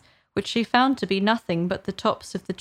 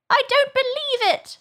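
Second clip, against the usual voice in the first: very high squawked denial; disbelieving pigeon. A narrator's reading. Pigeon rejecting Alice's claim, vehement disbelief. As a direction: screech your refusal in a bird voice.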